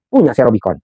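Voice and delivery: normal talking voice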